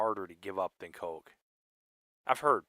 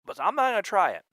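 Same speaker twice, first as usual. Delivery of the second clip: high excited voice; 8 semitones higher, 5 dB louder than usual. Retold or imitated. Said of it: "I'm like".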